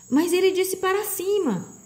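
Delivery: high-pitched indignant protest; bitter complaint. A narrator's reading. Milo protests from the bottom of the pit, bitterly. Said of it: "Milo reclamou amargamente".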